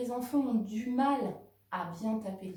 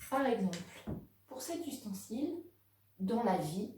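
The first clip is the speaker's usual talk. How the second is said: ordinary delivery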